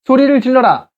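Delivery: mock rallying shout; commanding call. Shown as a demonstration. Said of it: "그래서 shout".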